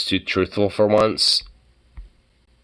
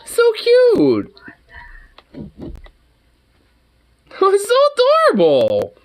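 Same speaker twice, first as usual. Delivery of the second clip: sweetly morbid. Real, not acted. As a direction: coo at it, much higher. Cooing at baby cobra video.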